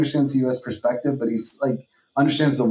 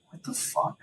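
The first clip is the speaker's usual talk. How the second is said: whispered, much softer disbelieving aside; stunned whisper; 3 semitones higher, 11 dB quieter than usual. Retold or imitated. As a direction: breathe it out under your breath, shocked. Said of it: "and everyone's just like".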